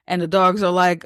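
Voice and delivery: ordinary talk, nothing marked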